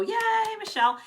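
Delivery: a big, high celebratory yay; excited cheer. Real, not acted. Host cheering the winner of the pen set, excited high yay. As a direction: cheer her on for winning.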